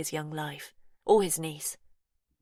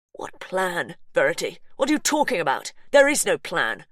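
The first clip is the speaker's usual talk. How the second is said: louder, rising disbelief; alarmed demand; 7 dB louder than usual. Acted. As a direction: push back, bewildered. Brother confronts her, alarmed.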